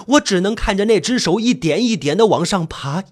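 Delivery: usual speaking voice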